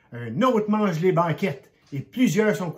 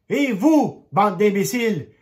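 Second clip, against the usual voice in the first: high, loud yell at the pigs; shout; 5 semitones higher, 8 dB louder, slower than usual. A narrator's reading. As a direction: bellow it to scare the pigs. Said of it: "et crie". (crier fort et aigu sur les cochons)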